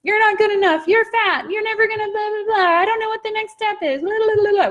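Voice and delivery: a rapid, mocking squeak of self-doubt; Mickey Mouse ego voice. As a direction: play your inner critic as a high, squeaky cartoon mouse. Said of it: "when your ego's chattering".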